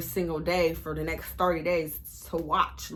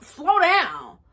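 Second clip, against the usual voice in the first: getting mad; 8 semitones higher, 5 dB louder than usual. Retold or imitated. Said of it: "Now I'm getting mad".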